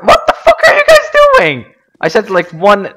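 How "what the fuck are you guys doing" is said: high-pitched furious yell at teammates; angry shout. Real, not acted. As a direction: scream it at careless teammates. Teammates ignoring his call.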